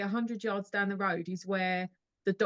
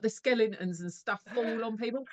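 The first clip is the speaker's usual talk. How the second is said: normal talking voice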